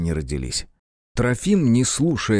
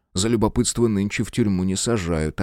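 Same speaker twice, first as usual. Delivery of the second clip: plain reading voice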